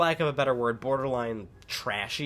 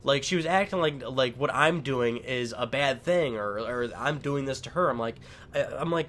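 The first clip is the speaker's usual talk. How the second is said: normal talking voice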